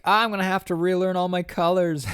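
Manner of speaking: high, loud put-on voice; hammed-up high-pitched distress; mock overwhelmed wail. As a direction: wail it comically, as if overcome. Voices the man overwhelmed by colours.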